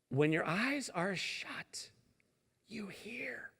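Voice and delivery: slow, then drop to a whisper on you hear; soft slow hush fading to whisper. Shown as a demonstration. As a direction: ease into a hushed whisper. Slowing into whisper.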